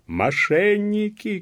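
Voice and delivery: high, drawn-out furious yell; angry shout. A narrator's reading. Police officer yells at the peasants, angry shout. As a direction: scream the accusation, stretching it out. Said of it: "кричал пристав".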